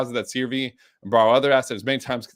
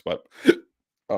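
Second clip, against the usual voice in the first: hiccuping mid-sentence; a sudden hiccup gasp; 4 semitones lower than usual. Real, not acted. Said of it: "excuse me, I have the hiccups".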